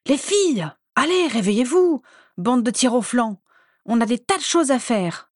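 high, loud and bossy; loud wake-up call. Acted. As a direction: roust them out of bed. Bellatrix rousing sleeping sisters.

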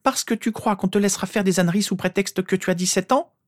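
sustained high, scandalised tirade; indignant scolding. A narrator's reading. Mother to son claiming nearly adult age, indignant and high. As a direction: rattle the question out high, without a break.

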